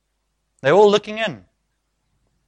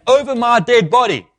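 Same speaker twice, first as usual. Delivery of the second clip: acting out Peter's heated refusal, pitched up; passionate, loud protest; 6 semitones higher, 6 dB louder than usual. Peter refusing to let Jesus die, passionate protest. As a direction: dig in loudly, refusing to allow it. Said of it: "Peter's probably quite passionate, so he says it quite loudly".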